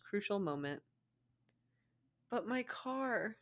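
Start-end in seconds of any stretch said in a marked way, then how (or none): 2.3-3.4 sniffled. Acted. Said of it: "she sniffled".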